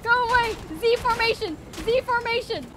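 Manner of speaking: hammed-up panic calls; frantic shouting. Real, not acted. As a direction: yell while dodging. Fleeing attackers, shouting.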